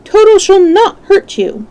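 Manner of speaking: very high, carrying call. A narrator's reading. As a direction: call after the fleeing mice. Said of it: "he called to the mice".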